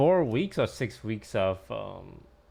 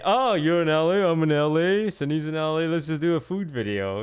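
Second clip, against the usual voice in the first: louder and higher breezy pitch; mock easy offer; 5 semitones higher, 7 dB louder than usual. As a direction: toss it off like it's nothing. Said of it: "it's easy for me to say".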